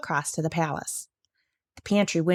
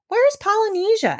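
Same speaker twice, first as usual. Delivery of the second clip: much higher, worried question; crocodile voice; 4 dB louder than usual. A narrator's reading. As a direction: ask it high and alarmed. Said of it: "asked the crocodile".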